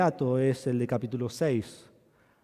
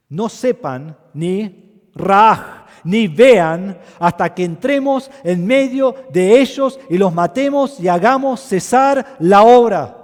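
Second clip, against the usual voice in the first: much higher and louder, slow menacing threat; enemies threatening; 7 semitones higher, 14 dB louder, slower than usual. Retold or imitated. Preacher voicing the enemies threatening to kill the builders, loud. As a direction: hurl the enemies' threat at the people. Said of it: "nuestros enemigos dijeron".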